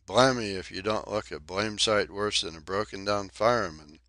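plain reading voice

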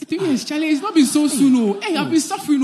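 high, loud laughing complaint; exaggerated wail. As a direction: overplay the suffering, laughing. Jobless friend's exaggerated complaint.

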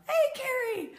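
high-pitched delighted hello; calling out greeting. Real, not acted. Speaker spotting Carrie mid-talk, calling out a greeting. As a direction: call out brightly to someone you spot. Greets someone she spots while talking.

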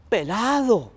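high, loud outraged cry; Tío Conejo is appalled at being skinned, a loud shocked cry; shocked outcry. Acted. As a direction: yelp it in disbelief. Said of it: "dijo Tío Conejo".

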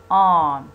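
arm said loudly and clearly on its own; loud model word. Shown as a demonstration. Teacher reads the transcription arm aloud for the class. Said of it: "The transcription is".

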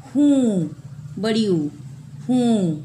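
हूँ said loud and punched, with a long "ऊ"; emphatic correction. Shown as a demonstration. Teacher insisting on the correct spelling to the class, loud. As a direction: hammer home हूँ, बड़ी हूँ, stressing the long u. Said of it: "यहाँ हो जाएगा".

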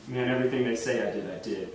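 put-on low drunken confession, trailing to very soft; wasted man. Drunk jailed friend confessing to his caller, low and wasted. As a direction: imitate a drunk, voice sinking away. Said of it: "He's just kind of, like, speaking nonsense".

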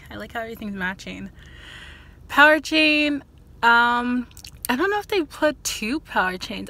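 finger stretching mouth corner; talking while showing braces; lips pulled back by fingers. Real, not acted. Seen 1: Fingers pull lips and cheek aside to show braces; brief gap near 11.2.

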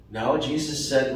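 ordinary talk

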